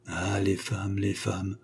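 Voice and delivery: à mi-voix, beaucoup plus bas, pour soi; murmurez-le comme une plainte désabusée; murmuré. A narrator's reading. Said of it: "murmura-t-il". (murmure désabusé pour lui-même)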